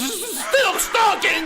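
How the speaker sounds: panicked exclamation. Acted. Principal spotting silk stockings before his visitor, alarmed cry. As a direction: gasp it out as the evidence appears.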